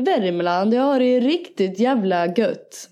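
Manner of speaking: slow Värmland dialect impression; Värmland accent. Showing co-host some dialect, Värmland accent. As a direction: lay on a drawling Värmland accent. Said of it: "Det är lite värmländska".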